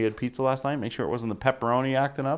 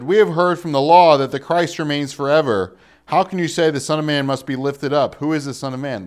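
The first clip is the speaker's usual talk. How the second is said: crowd's challenge; 2 semitones higher, 8 dB louder than usual. A narrator's reading. Reads crowd challenging Jesus.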